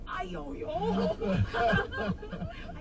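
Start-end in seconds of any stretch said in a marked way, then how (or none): 0.1-1.2 slow, higher mock grunt through a laugh; one-up your friend's groan, laughing; competitive grunt. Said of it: "then I'm just like".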